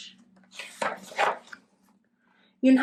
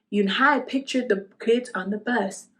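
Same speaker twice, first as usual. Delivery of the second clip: plain reading voice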